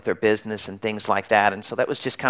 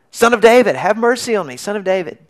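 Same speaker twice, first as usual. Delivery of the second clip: higher and louder desperate cry, strongest on the first words; yelling even louder; 9 semitones higher, 4 dB louder than usual. Retold or imitated. Blind beggar yells louder at Jesus, desperate plea. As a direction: shout over the crowd who tried to hush him. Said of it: "He yells even louder".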